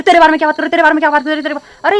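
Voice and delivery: the group's defensive taunt, loud then trailing; mocking echo. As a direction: parrot her question back at her. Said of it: "तो एकदम से वो सारे के सारे".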